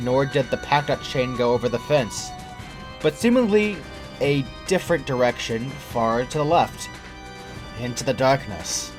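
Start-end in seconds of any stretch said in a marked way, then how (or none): none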